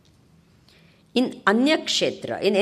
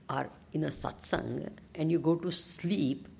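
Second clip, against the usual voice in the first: whispering; 5 semitones lower, 7 dB quieter than usual. Acted. Teasing aside about sleeping.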